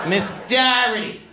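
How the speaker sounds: put-on "Miss Doherty" in a high, loud Boston accent, said like "Miss Tarety"; Boston mispronunciation. The teacher saying her own name, in a Boston accent. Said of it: "She mispronounced her own name".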